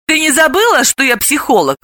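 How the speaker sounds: a touch of poison in the voice; venomous. A narrator's reading. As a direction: needle your friend. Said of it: "ядовито поинтересовалась Самсонова".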